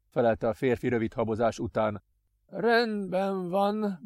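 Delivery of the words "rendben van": high, very slow scheming master; cold master. Acted. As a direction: savour each word. Master Adrian bargaining.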